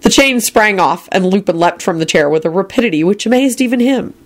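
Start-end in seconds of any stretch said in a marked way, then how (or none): none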